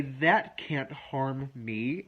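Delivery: each word slow and firm; slow, deliberate affirmation. Shown as a demonstration. Coach shows clients the calming phrase, slow and deliberate. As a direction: say it slowly so it sinks in. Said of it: "And then you say".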